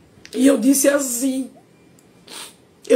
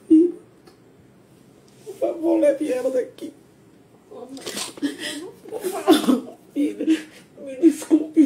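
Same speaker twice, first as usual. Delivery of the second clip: crying. Real, not acted. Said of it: "Eu não quero que ela veja eu chorando".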